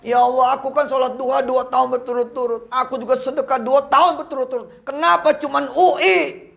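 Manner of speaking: high, pleading mock sob of a student; retold crying prayer. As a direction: imitate a student wailing to God, half-acted.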